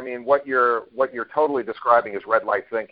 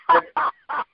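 real high, barking seal laugh; high bursts of honking laughter; 14 semitones higher than usual. Real, not acted. Man recalling his boss's seal jibe, laughing like a seal. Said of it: "You sound like a seal".

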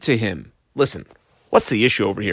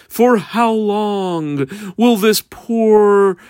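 tearful plea; 6 semitones higher, much slower than usual. Acted. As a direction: plead through hammed-up tears, slowly. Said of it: "she was crying".

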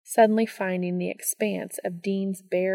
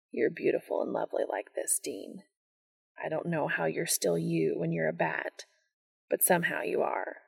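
tender whisper; 5 dB quieter than usual. Acted. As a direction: breathe it lovingly.

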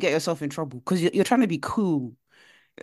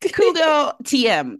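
mocking; 7 semitones higher, 6 dB louder, much slower than usual. Real, not acted. Mocking the cool girl label.

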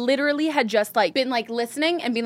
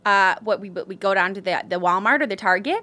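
Midwestern accent; 3 semitones lower than usual. Shown as a demonstration. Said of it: "like, Midwestern".

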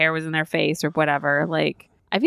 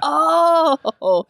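high, louder mock "oh" of realisation; exaggerated, pitched-up realisation; dawning realisation; 9 semitones higher, 5 dB louder than usual. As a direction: replay the penny dropping, hammed up. Retelling moment of realisation.

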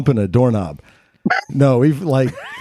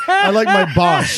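proud shout; 11 semitones higher than usual. Acted. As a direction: belt it out proudly. Proud exclamation.

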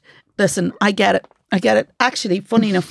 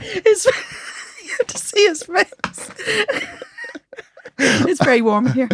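laughing; 11 semitones higher than usual. Real, not acted. Laughing at his reaction.

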